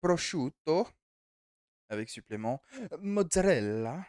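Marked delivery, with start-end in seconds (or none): 0.0-0.9 Prosciutto with a hammed-up Italian accent, higher and louder; mock Italian, roll out Prosciutto like a chef. Streamer ordering pizza online, in a playful Italian accent. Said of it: "Attention à l'accent". 3.0-4.1 Mozzarella stretched slowly with a mock Italian accent, higher and louder. Streamer adding a pizza topping, in a slow mock Italian accent. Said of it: "Attention à l'accent".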